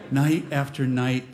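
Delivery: usual speaking voice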